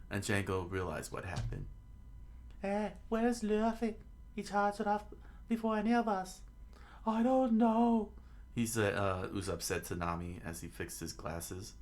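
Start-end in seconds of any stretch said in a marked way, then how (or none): 2.6-8.1 very high falsetto voices; high put-on dialogue voice; puzzled companions. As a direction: squeak through the exchange like cartoon characters.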